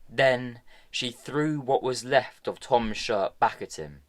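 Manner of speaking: plain reading voice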